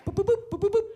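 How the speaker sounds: high-pitched rapid boops; imitated typing blips. Comedian imitating a reply being typed, high blipping boops. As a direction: mimic little typing dots with high boops.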